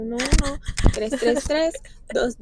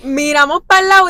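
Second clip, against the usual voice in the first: excited retelling; 3 semitones higher, 10 dB louder than usual. Real, not acted.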